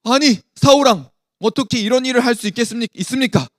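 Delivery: loud, high, rapid-fire scolding; angry rebuke. Acted. Samuel confronting King Saul over the offering, angry. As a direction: burst out indignantly at the king. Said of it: "화를 내면서 사울에게 이야기를 했어요".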